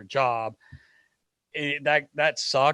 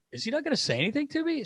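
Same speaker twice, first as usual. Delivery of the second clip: high, quick incredulous question; retold impatience; 14 semitones higher, much faster than usual. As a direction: pitch it way up, fast and baffled. Retold impatient wait for news.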